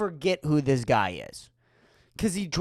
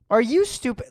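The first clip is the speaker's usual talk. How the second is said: louder repeat; 6 semitones higher, 4 dB louder, faster than usual. Acted.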